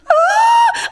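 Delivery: very high, loud retold shriek; hammed-up scream of dismay; mock horrified scream. Vlogger retells seeing classmate cry, mock shriek.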